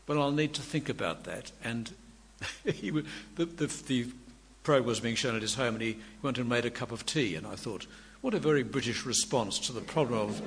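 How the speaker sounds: ordinary delivery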